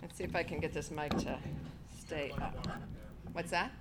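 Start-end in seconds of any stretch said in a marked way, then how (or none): none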